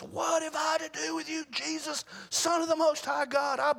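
high strained demonic shout; shrieking possessed man; loud demon voice. Acted. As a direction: cry out like a tormented demon begging Jesus. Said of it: "and with a loud voice".

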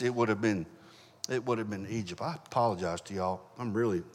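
real emotion catching in the throat, quiet; Heqt... no, "Egypt" said softly as the voice breaks with feeling; choked up. Real, not acted. Preacher moved while telling the congregation, choked-up quiet voice. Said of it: "getting choked up up there".

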